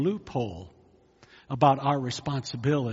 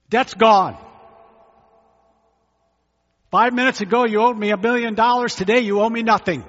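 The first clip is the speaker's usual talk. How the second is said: loud, fast, higher proclamation; grand pardon; 7 semitones higher, 10 dB louder than usual. Acted. Generous master cancels the debt, loud and fast. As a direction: announce the cancelled debt loudly.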